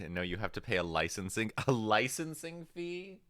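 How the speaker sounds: laughing through the words; giggling while talking. Real, not acted. Laughing at chat joke.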